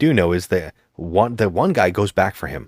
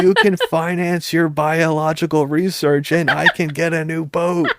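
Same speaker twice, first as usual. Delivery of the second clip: mock heroine; 9 semitones higher than usual. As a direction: ham up the heroine's cheesy line. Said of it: "And she goes".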